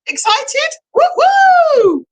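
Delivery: high, loud woo-hoo cheer; excited whoop. Real, not acted. Host hyping the live audience before the prize draw, excited whoop. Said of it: "I'm excited".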